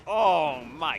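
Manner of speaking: loud startled oh; shocked gasp. Real, not acted. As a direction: react to the crash. Watching crash replay.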